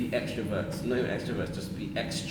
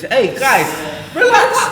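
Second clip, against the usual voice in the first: high, very loud shout over the group; loud call to order; 14 dB louder, slower than usual. Real, not acted. Host to arguing guests, shouting to calm them. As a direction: shout them down to get control.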